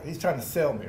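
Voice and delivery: ordinary talk, nothing marked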